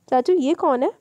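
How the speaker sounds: put-on a curious small boy asking his uncle; child voice. Little boy Hadeed asks uncle.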